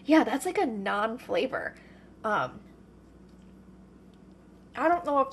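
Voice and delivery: talking with a mouth full; chewing while talking, mouth full. Real, not acted. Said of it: "with my mouth full".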